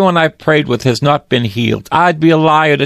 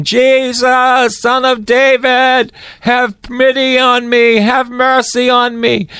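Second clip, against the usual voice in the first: high, slow desperate plea; blind man crying out; 8 semitones higher, slower than usual. Acted. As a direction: cry out like the blind beggar begging for mercy. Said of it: "Bartimaeus started screaming".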